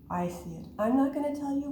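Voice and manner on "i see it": ordinary delivery, nothing marked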